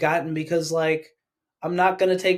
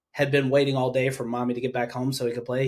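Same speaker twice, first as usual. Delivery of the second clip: ordinary talk, nothing marked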